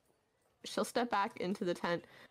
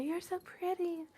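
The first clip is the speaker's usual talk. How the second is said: higher, softer and slow; soft admiring; 10 semitones higher, 4 dB quieter than usual. Acted. Woman petting the unicorn, soft slow cooing. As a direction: coo it fondly, almost to herself.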